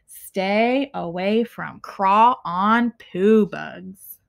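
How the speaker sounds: usual speaking voice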